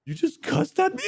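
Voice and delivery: stunned he said that to you; high-pitched shocked question; disbelief. Retold or imitated. Shocked at therapist cussing.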